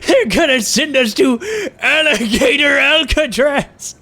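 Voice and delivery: cartoon punchline. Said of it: "He's like".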